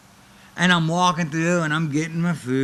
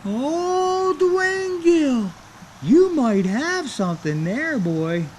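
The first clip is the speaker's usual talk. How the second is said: much higher, slow, drawn-out voice of a scheming manager; Paul Bear impression; 13 semitones higher, 3 dB louder, much slower than usual. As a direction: savour each word like a sly manager smelling money. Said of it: "And Paul Bear's like".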